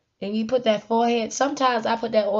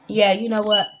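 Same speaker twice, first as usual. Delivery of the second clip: usual speaking voice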